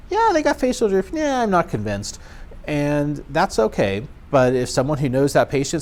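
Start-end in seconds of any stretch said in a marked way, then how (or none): 0.1-1.1 high-pitched quoted colleague; mock disagreeing colleague. Instructor voicing one side of a staff disagreement, mock high voice. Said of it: "we don't always agree". 1.1-2.2 lightly acted doubt, starting high and falling; mock doubtful colleague. Instructor voicing the other side of a staff disagreement, mildly doubtful. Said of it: "we don't always agree".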